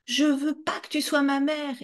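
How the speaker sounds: high, tearful screaming; child screaming hatred at the mother; hateful shout. Retold or imitated. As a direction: scream the hate out. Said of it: "Et je criais".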